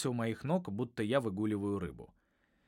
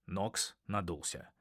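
ordinary delivery

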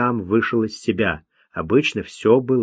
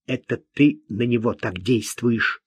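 plain reading voice

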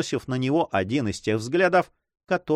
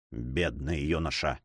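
much softer, lower and slow; whispered pity; 9 semitones lower, 8 dB quieter than usual. A narrator's reading. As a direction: breathe it out in pity. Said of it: "прошептал Дон Санчо".

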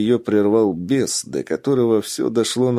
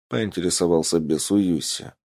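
plain reading voice

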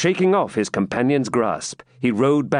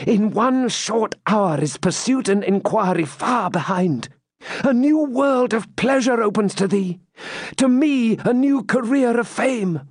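a higher, eager knight's voice urging her on; Templar pleading; 8 semitones higher than usual. Acted. Templar urging Rebecca to ride away with him, eager and higher. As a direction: tempt her with escape, rising on the big promises.

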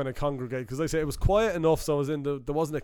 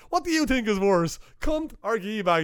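higher, slowed mock quiz; retold puzzled question; 7 semitones higher than usual. Kane Hodder polling another actor, higher puzzled question. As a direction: ask it like it matters. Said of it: "He's like".

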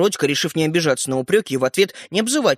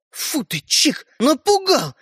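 startled, slow, high outcry; startled exclamation; 10 semitones higher, much slower than usual. A narrator's reading. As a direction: jump and cry out, forgetting to whisper. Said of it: "воскликнул он, забыв о том, что надо говорить тихо". (испуганное восклицание)

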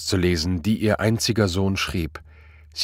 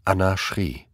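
usual speaking voice

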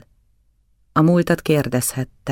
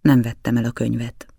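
ordinary delivery

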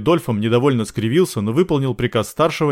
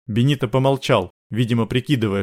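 plain reading voice

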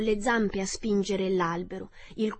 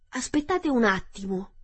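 scarecrow exclaiming; 3 semitones higher than usual. A narrator's reading. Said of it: "esclamò lo spaventapasseri".

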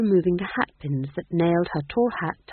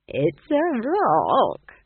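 high, very slow, amused delivery; chuckling old witch, long high vowels; chuckled; 7 semitones higher, 5 dB louder, much slower than usual. A narrator's reading. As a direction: draw it out slowly with amusement. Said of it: "chuckled Nanny Ogg".

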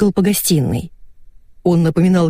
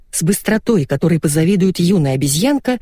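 usual speaking voice

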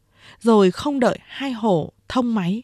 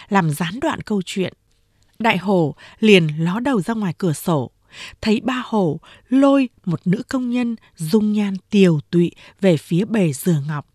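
plain reading voice

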